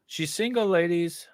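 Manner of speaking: high, teasing announcement; mock announcer. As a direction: sell her like a dating ad. Mock dating ad.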